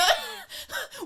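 high squealing burst of laughter; sudden high-pitched cackle; shrieking laugh. Real, not acted.

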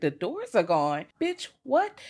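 stunned, echoing it back; disbelieving dispatcher. Retold or imitated. Dispatcher repeats the boy's words in disbelief. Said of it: "They're like".

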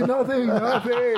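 high, giggly nothing nothing; nervous laughing brush-off; laughing denial. Retold or imitated. Hiding panic from Sue, laughing.

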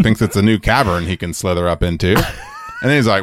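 normal talking voice

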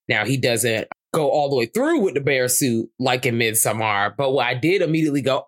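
ordinary delivery

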